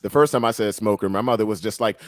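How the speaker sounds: usual speaking voice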